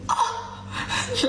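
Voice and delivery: long high gasp, mostly breath; hammed-up shocked "oh"; re-enacted shocked gasp. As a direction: mimic a drawn-out gasp of shock. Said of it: "j'étais choquée".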